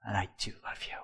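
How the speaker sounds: breathy whisper to God; praying to the Lord in a hushed whisper; whispered prayer. Retold or imitated. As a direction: confide it in a whisper.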